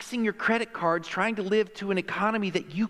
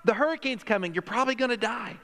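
high-pitched mock alarm; raised, urgent evacuation call; urgent news warning; 7 semitones higher than usual. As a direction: warn them like disaster is coming. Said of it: "everybody on the news was going".

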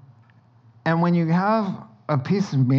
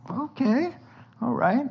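higher, skeptical okay; mildly unconvinced reply; doubtful okay; 8 semitones higher, 3 dB quieter than usual. Retold or imitated. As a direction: humour him with doubt. Reacting to firefighter plan.

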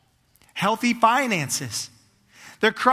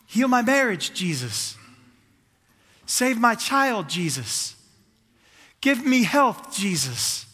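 imitated crowd pleas; slower than usual. People asking Jesus for what they need, calling out pleas. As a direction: plead each request, then sink on the name. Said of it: "They're crying this".

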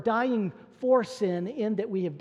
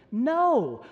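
NO higher and louder, one firm burst; emphatic no; 5 semitones higher, 5 dB louder than usual. Retold or imitated. Preacher answering as Paul would, emphatic no. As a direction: cut off the question with a loud "No". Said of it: "Why was Paul so emphatic".

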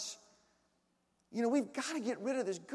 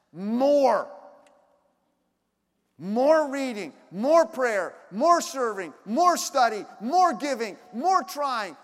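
each "more" punched loud; a weary, loud chant of "more"; hammered list; 9 dB louder, much slower than usual. Shown as a demonstration. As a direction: pound out every "more" like a demand. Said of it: "I'm getting exhausted just doing that list".

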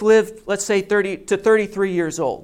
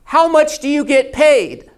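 loud, higher question hurled back; shouting back; 6 semitones higher, 5 dB louder than usual. Retold or imitated. Rabbi shouts his question back to the guard, loud. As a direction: call back across the dark. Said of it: "So the rabbi shouts back".

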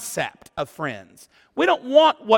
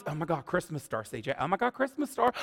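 hushed mock awe; 7 semitones lower, 12 dB quieter, faster than usual. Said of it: "everybody was".

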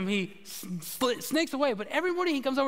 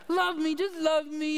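higher put-on doggy plea; pleading dog voice; 7 semitones higher than usual. Pastor voicing the dog begging for love, as a put-on plea. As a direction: beg like the dog nuzzling your knee. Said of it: "She says it in her own voice, but, you know, it's like".

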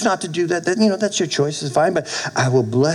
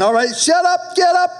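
commanding shout. Retold or imitated. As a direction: bark the order from above. God was saying.

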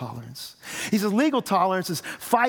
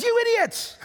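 mock furious yell. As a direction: yell it out, hammed-up outrage. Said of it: "yells out and says".

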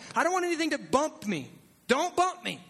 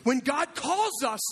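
usual speaking voice

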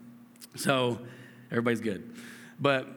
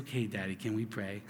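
much softer, gently asking; soft little daughter's voice; 7 semitones lower, 9 dB quieter than usual. Retold or imitated. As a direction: quietly voice the five-year-old asking to pray. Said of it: "she looked at me and said".

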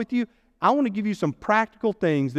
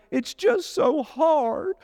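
mock whine; 6 semitones higher, much slower than usual. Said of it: "some men will say".

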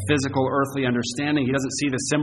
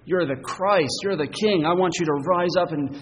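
higher-pitched, insistent objection; offended Peter; 6 semitones higher, 4 dB louder than usual. Acted. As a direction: plead indignantly with a leader who embarrasses you. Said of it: "He seems offended".

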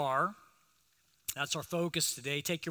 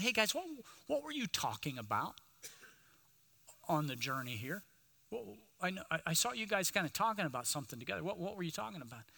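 softer, coaxing Jesus asking his friends; Jesus gently asking his disciples what they discussed, softer; gentle questioning; 8 dB quieter, faster than usual. Acted. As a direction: quietly draw the secret out of them.

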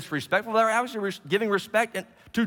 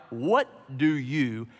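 slow, deliberate "what do you", each word set apart; weight on a drawn-out "you"; "you" held long, word by word; stress on YOU; 5 semitones lower, much slower than usual. Shown as a demonstration. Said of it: "notice this emphasis on the word you".

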